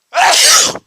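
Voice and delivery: high explosive sneeze. Real, not acted. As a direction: sneeze out hard. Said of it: "Oh, I just sneezed again".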